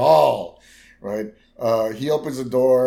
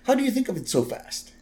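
high mock impressed old man; lightly voiced delighted boss; imitating Burns amazed; Burns quote; 9 semitones higher than usual. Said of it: "Bert's like".

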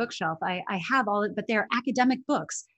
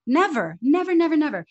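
each never punched higher and louder; emphatic repeated never; 6 semitones higher, 6 dB louder than usual. Real, not acted. To the interviewer, insisting students never say this, emphatic. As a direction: hammer home never, never, never.

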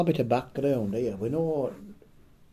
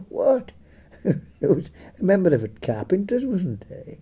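laughing through the words, pitched higher; 7 semitones higher than usual. Real, not acted. Teller laughing off his co-host's complaint about the joke, laughing. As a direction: giggle while protesting your innocence.

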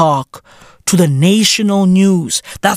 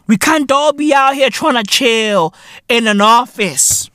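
normal talking voice

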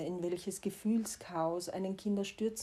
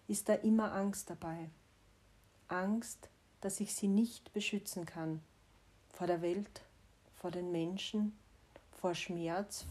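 usual speaking voice

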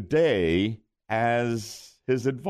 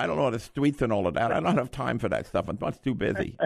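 Mike Tyson voice; 2 semitones lower, faster than usual. Acted. Host as the boxer greeting the winning caller, little teeny voice. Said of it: "finally recognized my voice".

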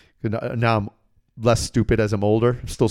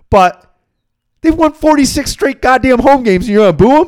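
indignant rant; 11 semitones higher, 7 dB louder than usual. Real, not acted. Telling how UConn fans booed their team, loud and outraged. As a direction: voice climbs high and loud in outrage.

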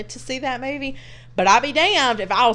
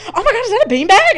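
high, loud, fast and laughing; breathless giddy discovery; excited squeal; 13 semitones higher, 10 dB louder, faster than usual. Retold or imitated. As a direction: gush over the bean bag like it's treasure. Spotting the bean bag, excited.

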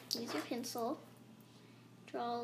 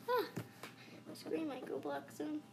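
ordinary talk

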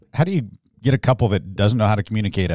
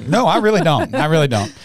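stubborn refusal; 6 semitones higher, 4 dB louder than usual. Retold or imitated. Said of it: "I'm like".

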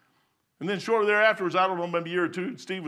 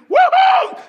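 high, loud fireworks whoop; whooping cheer; 14 dB louder than usual. Pretend. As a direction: let out a big high "woo-hoo". Cheering imagined July fourth fireworks.

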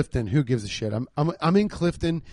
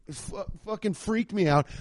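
ordinary talk

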